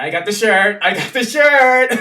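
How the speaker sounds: loud, high and laughing; excited about the shirt. Real, not acted. Vlogger bragging about his new shirt, giddy and loud. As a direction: show off the shirt, thrilled.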